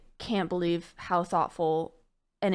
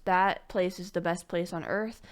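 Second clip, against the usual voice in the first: ordinary talk, nothing marked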